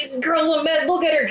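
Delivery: high mock-alarmed jeering; imitated yelling. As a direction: mimic a group yelling at someone. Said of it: "they started yelling".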